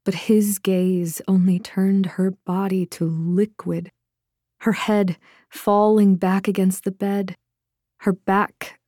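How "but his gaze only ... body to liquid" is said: ordinary delivery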